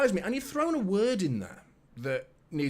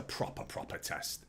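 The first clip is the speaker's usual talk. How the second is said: dropped to a near whisper, much softer; hushed; 10 semitones lower, 10 dB quieter than usual. Real, not acted. Fan warning viewers about a tough away game, hushed. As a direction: lean in and breathe the words. Stressing how big the game is.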